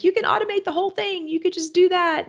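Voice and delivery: high, bright pushy advice voice; mock eager advisor. Quoting coaches urging automation.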